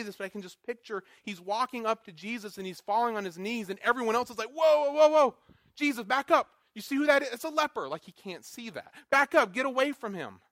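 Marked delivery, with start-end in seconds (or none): 4.5-7.9 alarmed crowd shout. Retold or imitated. Bystanders alarmed at leper approaching. 9.1-10.4 alarmed warning shout. Retold or imitated. Bystanders warn Jesus off.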